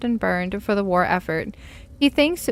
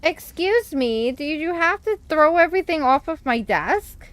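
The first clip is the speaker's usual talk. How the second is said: exasperated scolding; 7 semitones higher than usual. Real, not acted. Scolding cat on desk.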